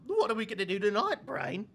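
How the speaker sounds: goofy British cartoon mouse; Pinky voice. Shown as a demonstration. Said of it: "He also does".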